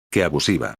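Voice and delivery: mild low grumble of annoyance; annoyed. Acted. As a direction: grumble it after slamming the table.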